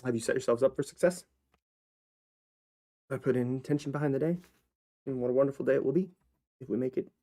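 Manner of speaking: motivational pep talk. Acted. Hyping up viewers in a cheerful coach voice. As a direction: sell the bright day like a guru.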